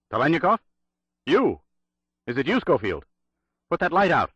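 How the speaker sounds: high, loud urgent calls; urgent cry. A narrator's reading. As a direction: cry out to the Russian in the dark. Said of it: "cried Bray".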